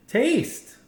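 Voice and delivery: bright high call of taste; excited call. A narrator's reading. Grandpa urging the little girl to taste, eager call. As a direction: urge her eagerly to taste.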